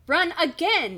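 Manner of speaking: loud, high acted scream. A narrator's reading. Kid urging friends out of the back room, screaming. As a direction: yell the order to flee. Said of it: "you scream at Stacy and Jason".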